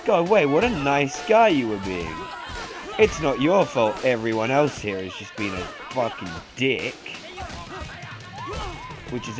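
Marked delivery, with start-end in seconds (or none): none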